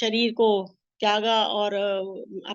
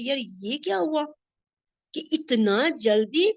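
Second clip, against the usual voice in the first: shocked disbelief; 4 semitones higher, slower than usual. Real, not acted. Reacting to the death news.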